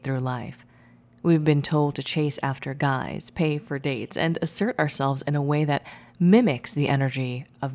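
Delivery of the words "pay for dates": ordinary delivery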